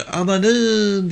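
high, drawn-out cry of dismay; alarmed cry. Acted. Old woman seeing the dirt, high cry. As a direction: cry out at the sight of the mess. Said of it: "diye bağırdı".

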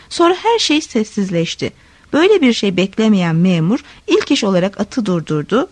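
ordinary delivery, nothing marked

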